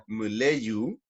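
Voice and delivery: "muleyu" with the last sound a U glide; final W as U. Shown as a demonstration. Teacher showing the W sound, "muleyu" ending like U. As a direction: end "muleyu" on a rounded U. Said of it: "suena al final como una U".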